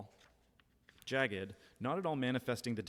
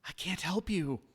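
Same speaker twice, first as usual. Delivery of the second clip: high, louder frightened refusal; a panicked, pitched-up no; terrified; 8 semitones higher, 5 dB louder than usual. Acted. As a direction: blurt it out scared and higher.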